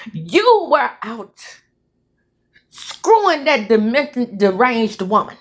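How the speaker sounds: deliberate, emphatic accusation; indignant wronged wife. Acted. Wronged wife at home confronting her betrayer, indignant. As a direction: confront them, stretching the key words.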